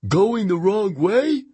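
high, slow, puzzled question; high-pitched voice of a confused teammate; questioning character voice. A narrator's reading. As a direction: ask it slowly, rising high at the end. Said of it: "he asked".

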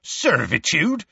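slow, high, rising incredulous query; scathing. A narrator's reading. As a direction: echo it back with scorn. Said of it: "said Hagrid scathingly".

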